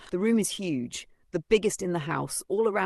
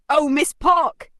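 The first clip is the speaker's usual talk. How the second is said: high, slow, loud taunting call; sing-song taunt at the villain; mock call-out; 11 semitones higher, 9 dB louder, slower than usual. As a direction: drag it out like a challenge.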